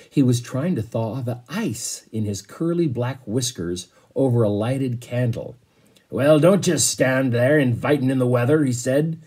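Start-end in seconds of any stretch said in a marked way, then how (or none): 6.1-8.6 a hint of a booming sea captain; lightly voiced roaring captain. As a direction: bark it a little like a cannon. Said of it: "in a voice like the roar of a cannon".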